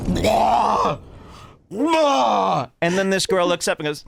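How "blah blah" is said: imitated puking. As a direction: act out throwing up with a big "blah". Imitating girls puking into the can.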